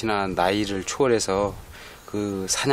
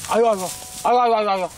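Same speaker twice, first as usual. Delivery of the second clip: high-pitched coaxing; 13 semitones higher, 4 dB louder, much faster than usual. Real, not acted. Owner urging old dog.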